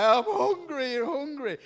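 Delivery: high, loud, whiny demand; hungry child. Retold or imitated. Hungry son in a French supermarket, loud whine. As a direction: whine it like a starving kid. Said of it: "And he's like".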